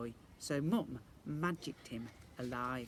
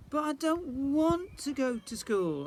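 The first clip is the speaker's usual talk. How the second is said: put-on high, slow, louder childish whine; whiny refusal; 12 semitones higher, 6 dB louder, slower than usual. A narrator's reading. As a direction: drag it out like a sulky child. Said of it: "I said".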